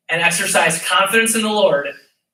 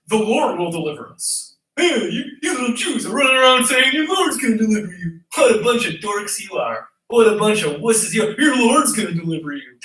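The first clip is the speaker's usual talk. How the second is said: sneering bully voice, pitched up; mocking taunt. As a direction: taunt them like a schoolyard bully. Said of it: "here he's gonna mock".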